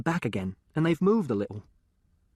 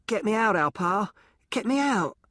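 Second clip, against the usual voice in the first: desperate cry; 8 semitones higher than usual. Acted. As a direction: beg for rescue at the top of the voice. Boy begging from the grave.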